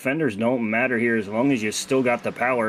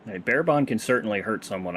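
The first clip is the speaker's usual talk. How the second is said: ordinary talk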